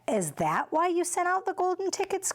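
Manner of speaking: put-on high eager child's question; excited cry. A narrator's reading. Charlie cries out to Mister Wonka, high and eager. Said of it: "cried Charlie".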